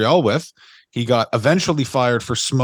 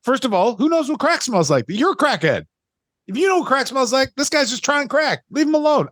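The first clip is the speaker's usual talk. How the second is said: much higher, incredulous pleading; exasperated defense; 9 semitones higher than usual. Retold or imitated. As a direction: stick up for the guy in disbelief. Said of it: "Like, I was like".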